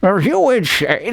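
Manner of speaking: retry the question with rising pitch at the end; [name]. Acted. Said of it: "Let me try it again".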